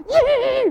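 very high, shrieked imitation neigh; horse whinny. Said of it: "And the horses shy".

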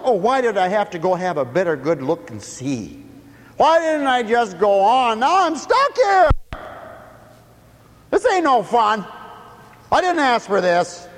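high, loud self-pitying whine; Simon complaining. Acted. Simon griping at carrying the cross. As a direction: moan like you're stuck. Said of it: "He said".

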